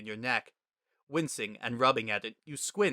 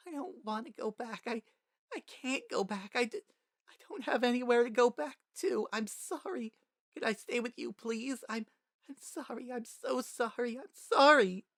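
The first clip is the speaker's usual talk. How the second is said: put-on crying child; 12 semitones higher than usual. A narrator's reading. Frisk pleading with Toriel through tears, high halting sobs. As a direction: beg through tears, words breaking. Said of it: "not enough to muffle your voice".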